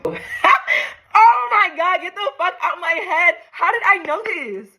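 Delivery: very high, drawn-out squealing wail; overwhelmed astonishment. Real, not acted. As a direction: wail it slowly, high, in total disbelief. Letter predicts her exact reaction.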